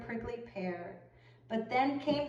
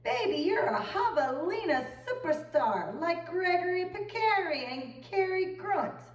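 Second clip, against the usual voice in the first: slick agent; 8 semitones higher than usual. A narrator's reading. Agent flatters Josefina, high slick patter. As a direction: sell it like a smooth talent scout. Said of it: "cheered Mister Lamb".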